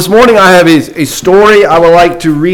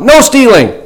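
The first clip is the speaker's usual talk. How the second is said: higher, raised call of a schoolboy; 9 semitones higher than usual. A narrator's reading. A schoolboy shouts a rule to the new teacher, called out higher. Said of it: "fellow yelled out".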